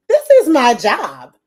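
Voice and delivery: high, loud burst of disbelief; drawn-out, high-pitched amazement; amazed exclamation. Retold or imitated. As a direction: exclaim it like a thrilled fan at work. Acts out her awed inner reaction.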